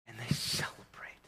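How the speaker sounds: hushed whisper; whispered awe. Real, not acted. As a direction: drop to a breathy hush for effect. Hushed after climax.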